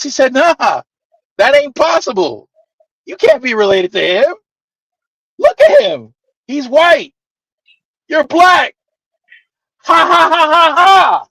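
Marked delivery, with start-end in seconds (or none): 0.3-8.8 mocking disbelief. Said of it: "Laughing in my face". 9.8-11.3 higher, louder fake laugh of the bartender; a hammed-up "ha ha ha" thrown in his face; mocking laugh. Bartender laughing off the claim of kinship, mock laugh. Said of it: "Laughing in my face".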